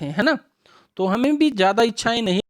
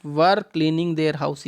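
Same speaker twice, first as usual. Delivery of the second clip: slow reading; 5 semitones lower, much slower than usual. Shown as a demonstration. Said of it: "rereads predicate part slowly".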